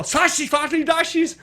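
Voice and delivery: high mock German gibberish; fake German. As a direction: babble fake German words. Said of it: "and then John Turturro".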